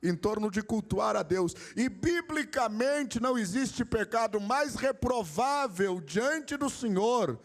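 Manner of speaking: high-pitched, driving sermon delivery; fervent preaching. Real, not acted. Pastor telling the brothers that life revolves around worship, fervent preaching.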